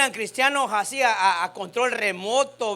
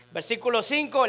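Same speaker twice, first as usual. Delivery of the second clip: normal talking voice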